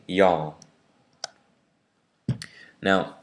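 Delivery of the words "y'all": y'all drawn out in a Southern accent, one slow syllable. Shown as a demonstration. Teacher demonstrating the Deep South y'all. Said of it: "in the Deep South, we say".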